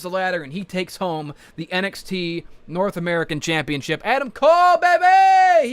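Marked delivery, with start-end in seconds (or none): 4.0-5.7 high, loud catchphrase shout; hype shout. Acted. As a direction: yell it like the crowd. Hyping the winner.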